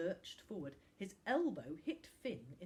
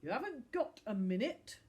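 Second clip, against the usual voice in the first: grumbling; 5 semitones higher, 7 dB louder than usual. Acted. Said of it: "grumbled the other man".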